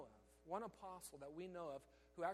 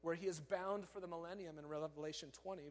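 ordinary talk, nothing marked

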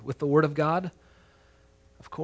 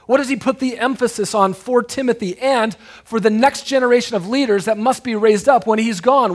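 higher, louder rhetorical questioning; full-force preaching; 6 semitones higher, 8 dB louder than usual. Real, not acted. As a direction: drive each question home to the congregation.

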